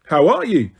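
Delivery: usual speaking voice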